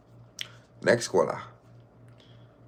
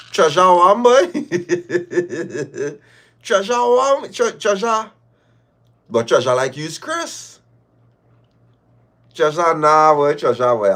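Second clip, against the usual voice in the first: higher, louder laughing tease; laughing mockery; 5 semitones higher, 5 dB louder than usual. Real, not acted. Caller laughing at Chris's treasure, higher. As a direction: laugh while mocking him.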